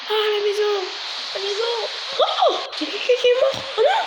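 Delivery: high-pitched alarmed wailing; mock horror. Gamer seeing the house hit, mock horror. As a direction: squeal in play horror at the damage.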